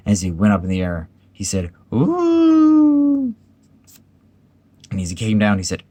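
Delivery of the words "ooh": long, very high loud hoot; high rising "ooh". A narrator's reading. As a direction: whoop as if flung into the air. Piglet bouncing up in the pocket.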